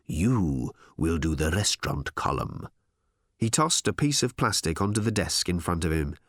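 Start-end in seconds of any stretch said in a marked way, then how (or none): 0.1-2.7 low, slow, deliberate instruction; measured bossy authority; firm order. Acted. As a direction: lay down the order word by word. Boss gives slow firm order.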